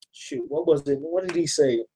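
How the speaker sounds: low, quiet murmur to self; puzzled self-questioning. Real, not acted. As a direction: ask yourself under your breath, low. Trying to recall a line.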